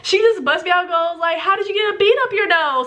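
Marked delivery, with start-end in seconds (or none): 1.4-2.9 high mock teacher's exclamation; imitated loud blurting. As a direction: blurt it out for everyone to hear. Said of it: "she just said so loud".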